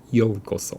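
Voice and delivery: "ようこそ" with the u sounded after yo; not this way: o-u. Shown as a demonstration. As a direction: say "ようこそ" as yo-u-koso.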